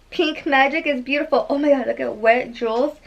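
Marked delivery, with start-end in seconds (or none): none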